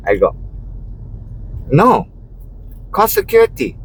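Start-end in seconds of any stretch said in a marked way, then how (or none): 1.7-3.8 raised voice. Retold or imitated. Me insisting they call security, raised voice.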